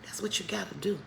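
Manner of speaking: soft unvoiced whisper. Real, not acted. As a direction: hush it like a secret. Whispered aside after preaching.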